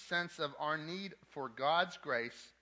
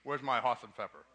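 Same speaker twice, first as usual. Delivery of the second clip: loud, gruff mock husband calling his wife; mock bossy husband; 3 semitones lower, 5 dB louder than usual. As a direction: play the bossy husband, tongue in cheek. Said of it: "That's how I address my wife at home".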